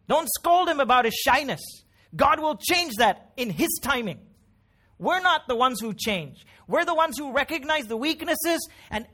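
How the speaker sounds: loud, high, forceful pulpit delivery; impassioned preaching. Acted. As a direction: preach at full force, punching key words.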